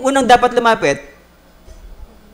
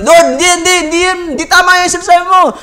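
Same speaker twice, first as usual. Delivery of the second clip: indignant protest; 9 semitones higher, 6 dB louder, faster than usual. Acted. Person arguing back at Lord.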